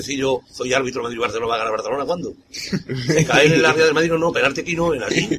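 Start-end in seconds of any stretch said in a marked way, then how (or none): 2.5-5.3 mock biased referee. Imagining himself refereeing Madrid-Barcelona, lightly voiced mock referee.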